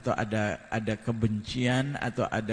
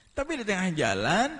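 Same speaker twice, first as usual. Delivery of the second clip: usual speaking voice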